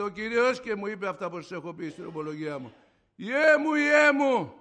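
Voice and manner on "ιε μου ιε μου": high, booming-loud call; thunderous, commanding voice of God, acted out; stentorian divine voice. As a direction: call out as a mighty Father to a son. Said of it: "αντρική στεντόρια, δυνατή, φοβερή".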